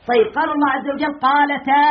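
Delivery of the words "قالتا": قالتا ending in a long "taa"; fatha before alif. Shown as a demonstration. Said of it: "حركناه بالفتحة لمناسبة الألف".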